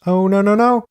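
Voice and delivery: high, loud run of protest; re-enacted cry of alarm; acted shout. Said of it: "again shouts".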